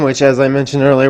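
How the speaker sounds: ordinary talk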